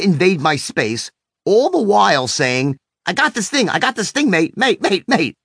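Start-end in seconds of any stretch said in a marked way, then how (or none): 3.1-5.4 higher, pushy mock Australian accent; imitating a pushy Australian. Pushy Australian inventor cornering the speaker, in a higher mock Australian accent. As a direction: ham up a pushy Australian inventor, pitch raised. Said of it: "with his thick accent".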